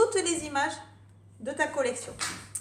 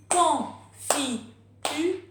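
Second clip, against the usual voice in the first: "confiture" very slow, each syllable apart, final E sounded; syllables counted out; 4 dB louder, much slower than usual. Shown as a demonstration. Teacher showing parents the final E, slow syllable beats. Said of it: "On compte les syllabes avec le E final".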